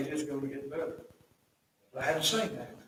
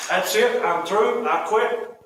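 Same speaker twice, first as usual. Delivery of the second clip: raised, higher voice of someone giving up; exasperated, loud and done with it; fed up; 6 semitones higher, 7 dB louder, slower than usual. Acted. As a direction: act out throwing in the towel. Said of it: "You know how you get tired?".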